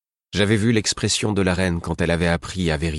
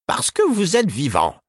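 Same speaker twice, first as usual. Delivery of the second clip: put-on higher, slow, curt old woman; 12 semitones higher than usual. A narrator's reading. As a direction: clip it short. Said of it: "fit Caudron d'un ton bref".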